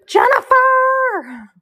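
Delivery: long, high, drawn-out call across the yard; calling a name. Retold or imitated. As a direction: call her name like she's far away. Mom calling out for daughter.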